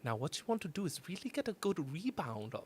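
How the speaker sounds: put-on soft German-accented instructor; hushed, polite German accent. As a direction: quietly explain it as a sweet German drummer. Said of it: "then he talks and he's like".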